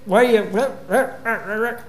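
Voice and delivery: mock yelling. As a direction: yell like angry farmhands. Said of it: "Yell and scream".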